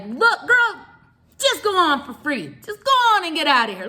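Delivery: high, loud, sassy wave-through; amused booth clerk. Retold or imitated. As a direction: shoo her through the gate, high and loud. Booth clerk waves her through.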